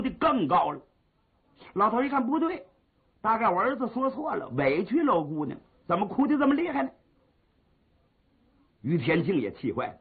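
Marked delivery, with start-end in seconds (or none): 5.9-6.9 quick, higher worried question; worried outburst. Retold or imitated. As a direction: rush it out, alarmed. Father's worried thought. (着急地快速发问)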